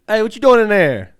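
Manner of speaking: loud, high holler; calling through door. Retold or imitated. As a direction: shout as if through a locked door. Acted calling through a locked door.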